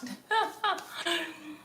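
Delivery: high burst of laughter; real delighted laugh. Real, not acted. Laughs over hot bread.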